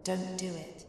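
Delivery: barely audible ghostly warning; a very soft, distant ghostly plea; ghost's warning. Retold or imitated. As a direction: let it fade out like a spirit's voice. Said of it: "The words she told me".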